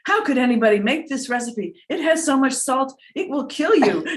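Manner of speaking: high, loud, laughing through the quote; mock outraged hater. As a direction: play an indignant commenter while laughing. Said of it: "you know, they say".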